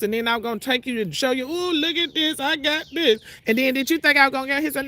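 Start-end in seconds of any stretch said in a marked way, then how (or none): 1.5-3.2 mock bragging. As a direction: ham up a show-off. Mocking imagined bragging.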